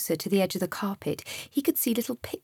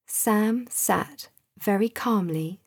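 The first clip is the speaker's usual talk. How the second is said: plain reading voice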